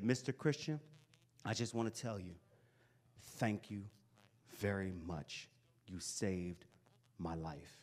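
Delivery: soft, falling, sincere thank you; thanking the teacher who saved him, softly; heartfelt thanks. Retold or imitated. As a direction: say it quietly from the heart.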